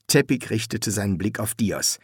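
plain reading voice

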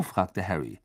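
usual speaking voice

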